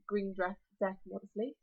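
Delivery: plain reading voice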